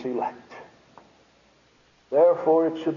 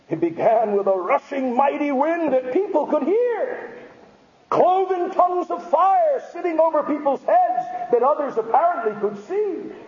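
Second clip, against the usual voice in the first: high, ringing pulpit proclamation; impassioned sermon delivery; full-force preaching; 12 semitones higher than usual. Real, not acted.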